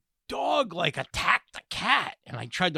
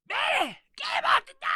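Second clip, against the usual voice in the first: high, shouting weird Southern lady; grandma impression; faster than usual. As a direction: call for Betty like a shrill old Southern grandma. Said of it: "this weird Southern lady".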